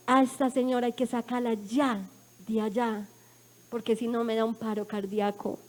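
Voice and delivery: subdued, serious quote of a doctor; grave retold verdict. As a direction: drop the voice low and soft for bad news.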